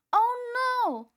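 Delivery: high, loud panicked "Oh no!"; shouting. A narrator's reading. Cheeseball tumbling off the roof, shouting in panic. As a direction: yell it as you fall. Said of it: "shouted Cheeseball".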